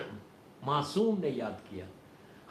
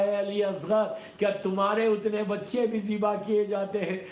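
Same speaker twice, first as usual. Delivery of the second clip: high lament in a mother's words; preacher voicing anguished grief; 5 semitones higher, 4 dB louder than usual. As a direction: cry out in agony as the bereaved mother. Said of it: "تڑپ کے کہا".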